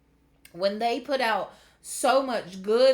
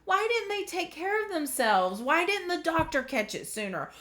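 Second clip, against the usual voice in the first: high-pitched, exasperated string of questions; higher, emotional protest at unfairness; angry questioning; 7 semitones higher than usual. Retold or imitated. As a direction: plead for answers in frustration. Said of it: "I get so angry because I'm like".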